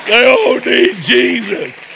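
slow, loud, high repeat of the friend's line. Retold or imitated.

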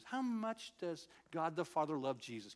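ordinary delivery

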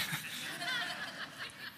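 a short, light, high-pitched laugh; a pastor chuckles at husbands wincing in the crowd; amused laugh. Real, not acted. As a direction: laugh at the husbands who cringed.

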